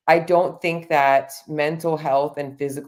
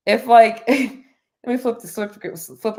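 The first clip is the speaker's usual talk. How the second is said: sudden high, loud laugh breaking in; laughing burst; 7 semitones higher, 5 dB louder than usual. Real, not acted. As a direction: laugh at herself mid-thought. Laughs mid-sentence.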